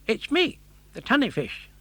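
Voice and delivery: high, quick friendly fish voice; a chatty fish, fast and bright; tunny fish. Acted. The tunny fish greeting Pinocchio, quick and cheerful.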